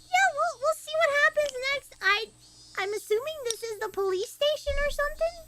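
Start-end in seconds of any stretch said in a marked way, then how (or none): none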